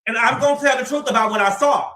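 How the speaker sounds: loud, excited call to the chat; hyped-up chat shoutout. Real, not acted. Hyping the viewers who typed fives, loud and excited.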